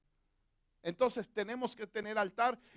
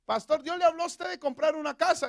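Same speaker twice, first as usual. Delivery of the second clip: lightly acted question, higher and louder; raised-voice question to the pastor; questioning congregant; 6 semitones higher, 5 dB louder than usual. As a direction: put an eager push on the question, lightly voiced.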